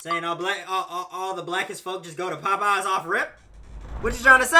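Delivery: loud joking outrage, rising to a yell; mock outraged shout. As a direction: play offended and shout it.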